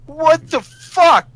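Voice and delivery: slow, loud, high what the fuck; stretched-out outraged question; exasperated. Real, not acted. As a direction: drag it out in disbelief. End of the cupcake rant.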